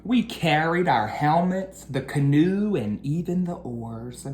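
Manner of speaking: lively storybook read-aloud; reading to children. A narrator's reading. Teacher reading a picture book to her class, lively storytelling. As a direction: read the rhyming story to the kids.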